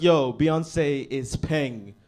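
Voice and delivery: ordinary delivery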